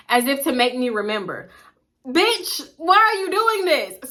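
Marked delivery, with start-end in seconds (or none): 2.0-4.1 higher, drawn-out mock exasperation; exasperated outburst. Venting at friend who keeps dragging it, exasperated. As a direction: demand an answer, pitch jumping up. Exasperated retort in retold quarrel.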